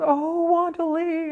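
high, slow, sung-out greeting; delighted call. Retold or imitated. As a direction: stretch the name out like spotting an old friend. Friend calling her name.

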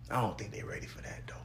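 breathy whispered joke aside. Real, not acted. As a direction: whisper it like a secret to the listener. Aside, they not ready.

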